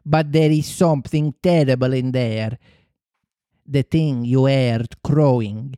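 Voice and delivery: put-on slow Italian-accented report; Italian accent, a touch of horror; gibbering Italian accent. Shaken Italian pirate reporting from the cabin, Italian accent. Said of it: "almost gibbering".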